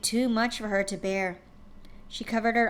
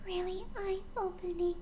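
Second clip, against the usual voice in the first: soft and shy; 9 semitones higher, 8 dB quieter than usual. Acted. Fluttershy softly telling friends what she learned, shy and hushed. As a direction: murmur it gently like a shy pony. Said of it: "Fluttershy smiled softly".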